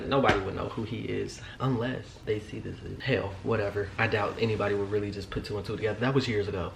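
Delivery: soft, low aside; quiet aside. Real, not acted. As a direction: mutter it to yourself.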